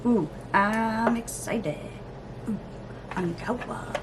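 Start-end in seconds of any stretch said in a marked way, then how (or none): none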